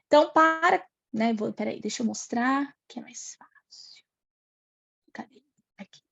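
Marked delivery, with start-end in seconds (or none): 2.9-6.0 whispered aside. Real, not acted. Aside while searching the screen.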